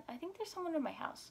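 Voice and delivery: high, soft and hurried; timid plea. Retold or imitated. Telling neighbours of an intruder.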